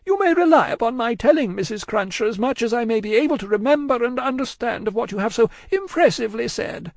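high, hurried woman's voice; distraught genteel woman. A narrator's reading. Miss Pross cutting short Cruncher's vows, anxious. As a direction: pitch it far up, anxiously polite.

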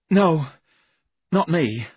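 little man's anxious no; uneasy refusal. Acted. Jerome refuses to go.

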